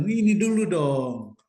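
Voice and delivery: slow, drawn-out mock brag; cheeky self-satisfied retort; playful boasting. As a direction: stretch it out with swagger. Playful boast in retold exchange.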